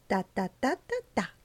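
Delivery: ordinary delivery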